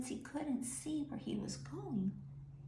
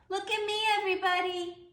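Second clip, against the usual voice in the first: much higher, louder showing-off shout; 11 semitones higher, 7 dB louder, faster than usual. Acted. Mayor's wife shouting to everybody from her boxes. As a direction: call out proudly from on high. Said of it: "climbed on top of her boxes and shouted".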